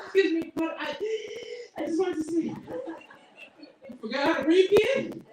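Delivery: put-on high kid voice gasping between words; breathless kid. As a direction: gulp air mid-sentence like a child.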